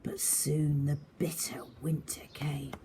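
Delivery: lively read-aloud for little ones; expressive picture-book reading; story narration. A narrator's reading. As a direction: paint the scene for young listeners. Reads story to children.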